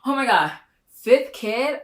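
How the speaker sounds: high, loud stunned gasp; can't believe what you just heard; shocked disbelief. Real, not acted. Stunned by Brittany's fifth baby reveal, high and loud.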